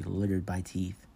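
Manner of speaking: plain reading voice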